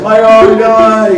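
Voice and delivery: bright, high, loud hello; excited greeting. Retold or imitated. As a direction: greet an old friend with delight. Greeting old friend on phone.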